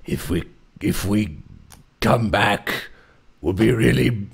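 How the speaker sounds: low, slow dopey villain; dopey deep voice. Retold or imitated. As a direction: drawl it in a deep goofy voice. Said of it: "my guy's just like".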